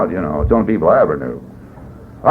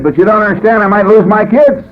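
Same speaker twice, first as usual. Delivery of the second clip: higher, pleading voice; desperate pleading; 10 semitones higher, 4 dB louder than usual. Retold or imitated. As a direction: beg him to understand. Pleading with sponsor.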